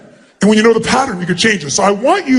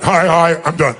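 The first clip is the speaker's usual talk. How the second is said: half-hearted; 5 semitones lower than usual. Retold or imitated. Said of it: "you didn't go and you went".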